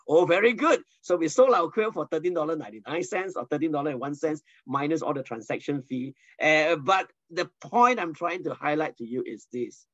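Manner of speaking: ordinary talk, nothing marked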